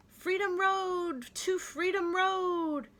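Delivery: slow, high ringing call. Acted. Freed people shouting joyfully about Freedom Road. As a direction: proclaim it like a crowd on the march.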